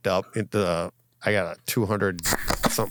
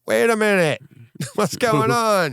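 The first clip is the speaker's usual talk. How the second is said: put-on higher, louder childlike whine, slowing on the end; confused kids; 12 semitones higher, 5 dB louder than usual. Kids asking their parent in a high childish whine. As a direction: play a puzzled little kid. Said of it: "you got kids going".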